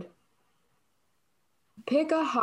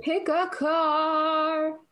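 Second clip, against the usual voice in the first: very slow, higher, stretched-out prompt; drawn-out announcement; 6 semitones higher, much slower than usual. Pretend. Host reading the next quiz prompt to her friend, playfully drawn out. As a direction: stretch every word out long and high.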